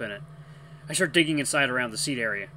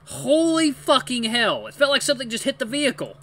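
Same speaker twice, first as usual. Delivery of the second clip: shocked exclamation; 10 semitones higher, 5 dB louder than usual. Acted. Shocked after the impact.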